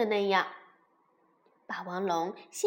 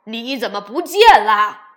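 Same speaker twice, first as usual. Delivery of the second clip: very loud furious roar; roaring shout; 16 dB louder than usual. Acted. Father dinosaur roars at the children over the missing one. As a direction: bellow it at the children. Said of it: "霸王龙大声吼道".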